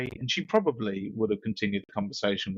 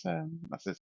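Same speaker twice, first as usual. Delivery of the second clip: usual speaking voice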